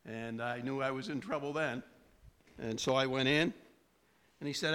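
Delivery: ordinary talk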